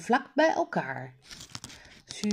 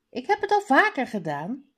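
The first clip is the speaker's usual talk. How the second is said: quick, higher-pitched reply; 6 semitones higher, 3 dB louder, faster than usual. A narrator's reading. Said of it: "zegt Suzanne vlug". (vlug en wat hoger)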